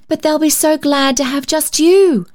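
put-on high, eager child's plea; high girlish plea; pleading cry. A narrator's reading. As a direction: coax her warmly like a child. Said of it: "cried Polly".

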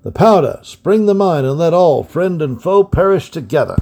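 high, loud stern order; stern old man ordering the mine sprung; emphatic command. A narrator's reading. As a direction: command it with full force. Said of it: "uttered with such emphasis".

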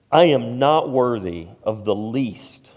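loud, preached scripture prayer; fervent prayer reading. Acted. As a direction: proclaim the prayer with force.

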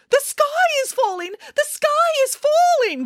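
panicked cry. A narrator's reading. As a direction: cry out like a hysterical little chicken. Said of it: "cried Chicken Little".

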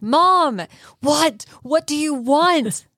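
high, slow, worn-out what-do-you-want; exasperated mom. Retold or imitated. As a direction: play a tired mother who has been called once too often. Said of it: "And it's".

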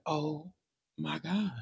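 very soft, hushed and slow; overwhelmed mom. Retold or imitated. Mom bursting into tears at the letters, hushed. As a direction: imitate a mom overcome, barely voiced. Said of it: "She burst into tears".

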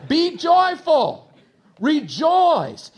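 high, drawn-out preacher's cry; exultant proclamation. Acted. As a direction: call the command out loud and slow. Preacher proclaiming the verse's command.

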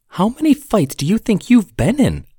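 put-on high, bright questioning young woman; curious young woman. Sarah asks.

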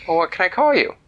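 high, loud exasperated plea. Acted. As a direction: throw up your hands. Girl exasperated.

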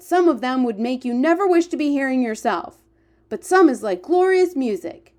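rapt, animated character voice; excited giant. Acted. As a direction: marvel aloud as a storybook giant.

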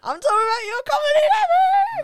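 high, full-volume shouted echo; mock shout. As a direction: shout it back way over the top. Playfully echoing the other's question loudly.

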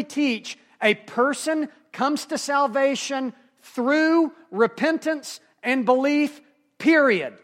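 forceful preaching. Acted. Preacher going to the mat for the congregation on salvation, forceful proclamation. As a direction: drive the doctrine home, building to a firm close.

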